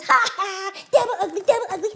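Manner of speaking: high, loud laughing taunt; boy cackling as he jeers; mocking laughter. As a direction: laugh cruelly at the fish. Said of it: "He's laughing down there on the beach".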